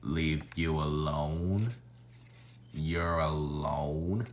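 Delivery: low, slow, menacing clown; creepy clown voice. Acted. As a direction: taunt slowly in a lightly creepy clown voice. Clown taunting scared victim.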